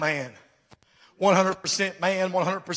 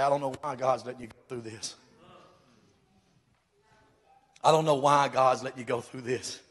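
doubtful gossip; 6 semitones lower, 7 dB quieter than usual. Retold or imitated. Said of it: "where people say".

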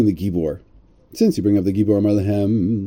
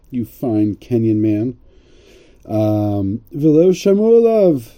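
ordinary talk, nothing marked

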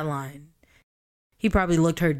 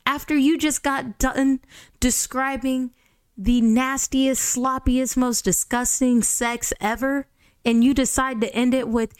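higher, louder ranting; exasperated rant; 5 semitones higher, 3 dB louder than usual. Real, not acted. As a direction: rant at the author. Ranting at the author.

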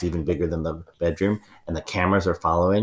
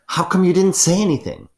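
shocked high exclamation; 12 semitones higher, 6 dB louder than usual. Acted. Ma'am exclaims loudly.

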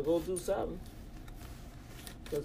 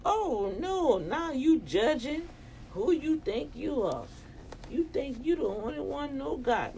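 mock indignation; 7 semitones higher than usual. Acts out a defensive retort.

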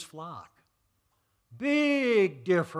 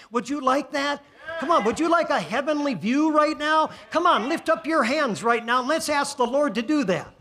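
rising, louder preacher's call; fervent altar-call preaching, louder and higher; preaching at full force; 4 semitones higher, 8 dB louder than usual. Acted. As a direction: lift the room, pushing louder each line.

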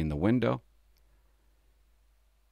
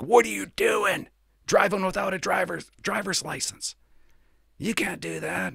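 livid commander; 10 semitones higher than usual. Retold or imitated. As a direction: bark it out like a livid watch commander. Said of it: "Livid. Lost his mind on me".

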